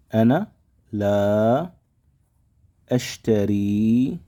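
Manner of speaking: slow drill. Shown as a demonstration. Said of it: "slow teaching drill".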